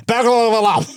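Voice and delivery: put-on Burglar alarm in a high Glaswegian accent, rolled. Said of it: "And he was like".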